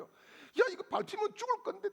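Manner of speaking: a tiny bug terrified of being stepped on; frightened bug. Pretend. The bug panicking at the elder's foot, high frightened squeak.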